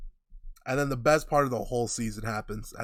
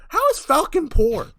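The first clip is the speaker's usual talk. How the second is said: much higher and louder, disbelieving; incredulous outburst; 8 semitones higher, 5 dB louder than usual. Real, not acted. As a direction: shoot it up in outrage, can't believe it. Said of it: "That's a great question".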